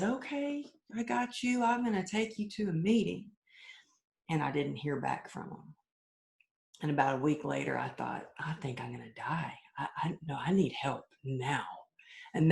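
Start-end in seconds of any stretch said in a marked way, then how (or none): 0.0-3.3 raised, lighter voice quoting a friend; friend's reassuring reply. Retold or imitated. Friend promising to take her to a meeting, lightly voiced. 8.4-11.6 hushed, softer replay of panic; desperate inner thought. Retold or imitated. Recalling the moment she knew she needed help, hushed and desperate.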